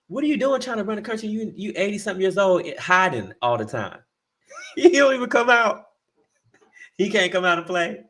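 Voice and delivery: high, fast, laughing mockery; mocking. Real, not acted. Mocking Biden's age to the cohost, high and laughing. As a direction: tease him with a laugh. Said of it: "mocking Biden".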